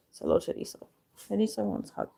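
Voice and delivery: breathy whispered hi to a pet; whispered hello. Real, not acted. Owner whispers a hello to the cat Teresa.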